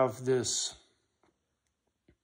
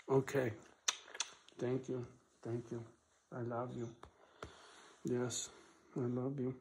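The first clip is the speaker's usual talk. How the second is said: tender to dog; 2 semitones lower, 14 dB quieter than usual. Real, not acted. Owner softly thanking and loving his dog. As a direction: murmur softly, like to a pet.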